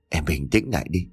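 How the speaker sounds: calm her down softly; soothing. Acted. Husband calming frantic wife. (chồng dỗ vợ đang hoảng loạn, giọng trầm dịu)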